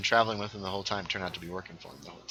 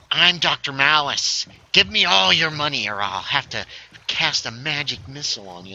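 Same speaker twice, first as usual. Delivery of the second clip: Doctor Malice; 3 semitones higher, 3 dB louder than usual. Retold or imitated. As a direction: announce yourself like a cartoon villain. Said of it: "he was this guy, talk like this".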